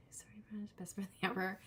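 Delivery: breathy whispered apology to a friend; whispered aside. Real, not acted. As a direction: drop to a whisper for a quick aside. Quick aside to friend off-mic.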